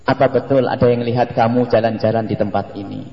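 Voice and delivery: low, composed parental inquiry; calm questioning. Acted. Mother questioning her child about wandering, calmly. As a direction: ask gently, without accusation. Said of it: "Kalau nanyanya kalem ya".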